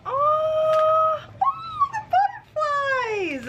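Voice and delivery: high tearful cry of joy over the butterflies; overwhelmed, half sobbing, half shouting with joy; real happy tears, voice cracking high. Real, not acted. Surprise gift opened, overwhelmed.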